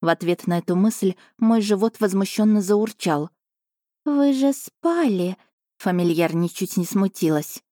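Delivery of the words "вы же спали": high, slow purring cat voice; a smug little cat savouring her dinner; chatty cat familiar. Acted. Cat familiar telling princess about dinner, high slow voice.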